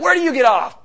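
loud, high, offended retort; mock indignant cry; indignant outburst. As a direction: snap back at an old enemy, hammed-up. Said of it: "she'd be like".

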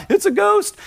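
high-pitched panicked exclamation; freaking out. Acted. Disciples in the boat seeing Jesus on the water, freaking out. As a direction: blurt it out like a frightened disciple. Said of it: "They're freaking out".

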